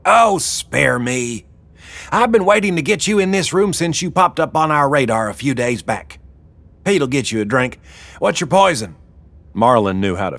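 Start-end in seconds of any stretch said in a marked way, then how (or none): 0.0-9.0 high-pitched boss woman brushing off excuses; domineering Wilma. Acted. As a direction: take charge, pitched high. Wilma cuts Marlin off.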